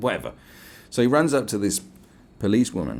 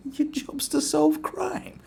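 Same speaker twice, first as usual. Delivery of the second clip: very high, slow, laughing question; mocking disbelief; much slower than usual. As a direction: echo the claim back as a laughable question. Incredulously echoes the officer's claim.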